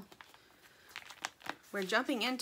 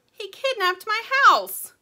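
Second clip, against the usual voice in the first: much higher, louder and slow cry; a tiny bird wailing over its lost house; distressed little bird; 12 semitones higher, 12 dB louder than usual. Acted. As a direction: wail it like a small bird in despair.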